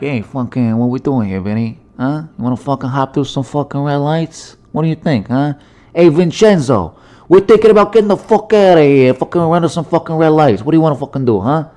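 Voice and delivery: put-on mob tough guy, rising in pitch; hammed-up gangster kangaroo plotting with his crew; mobster. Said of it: "why they call kangaroos a mob".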